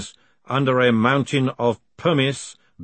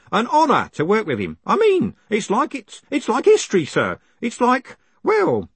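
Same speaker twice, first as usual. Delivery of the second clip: put-on much higher, eager old postman; excited old man voice; 9 semitones higher than usual. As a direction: gush like a proud old man. Groat voiced high, excited.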